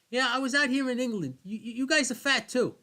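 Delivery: loud, high Boston rant; Bill Burr impression. Podcast host imitating Bill Burr's England joke, loud Boston yell. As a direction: shout it like an angry Boston comic. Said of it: "I like that one joke where he goes".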